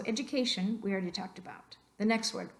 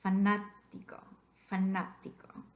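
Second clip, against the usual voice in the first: fanático stressed faNÁtico; fanático modeled with the second syllable strongest. Shown as a demonstration. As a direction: lean on the NA of fanático. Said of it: "accent mark over the second A".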